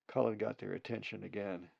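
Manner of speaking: plain reading voice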